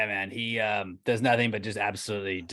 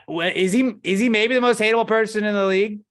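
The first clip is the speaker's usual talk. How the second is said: much higher, louder incredulous rant; indignant outburst; 11 semitones higher, 9 dB louder than usual. Real, not acted. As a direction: blurt it out in disbelief. Ranting about a hated player.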